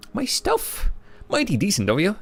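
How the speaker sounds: homeless man in a high Scottish brogue; a rough Scottish drifter grateful for his things; Scottish accent. Acted. Streamer voicing homeless man, first Scottish try. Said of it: "giving him a Scottish accent".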